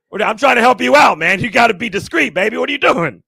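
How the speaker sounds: loud, high-pitched remonstrating; exasperated protest. Retold or imitated. Buyer trying to help his dealer out, exasperated protest. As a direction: plead your case at full voice.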